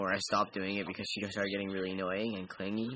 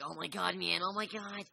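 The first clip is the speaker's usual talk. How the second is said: higher pitched dude exclamation; first take of the dude line; 7 semitones higher than usual. Shown as a demonstration. Trying out voices for the dude, first take. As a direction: try the line a bit up. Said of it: "I'll go with my normal voice".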